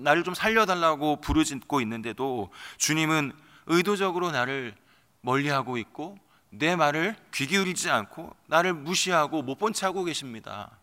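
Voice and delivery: ordinary delivery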